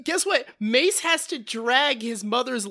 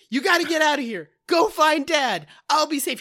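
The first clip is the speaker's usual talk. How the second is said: higher, hurried heroic command; acted urgency; 5 semitones higher, 5 dB louder, faster than usual. Older brother sends Cindy away during the attack, acted urgency. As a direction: imitate a brother urging escape, raised voice.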